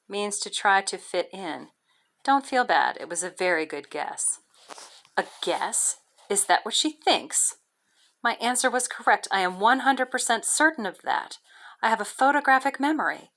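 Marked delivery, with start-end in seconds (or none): none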